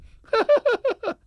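high, loud mock laugh; imitating a sudden high laugh from a coma; imitated laugh. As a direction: burst out laughing like the dying friend. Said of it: "he came out of a coma and he went".

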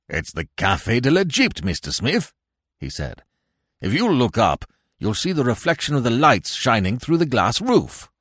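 lightly voiced police inspector; a hint of another man's voice; Inspector Weymouth. A narrator's reading. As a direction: point out a discovery in a slightly altered character voice.

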